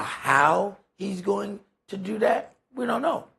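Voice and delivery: ordinary talk, nothing marked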